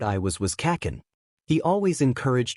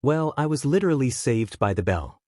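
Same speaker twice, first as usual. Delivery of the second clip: ordinary delivery, nothing marked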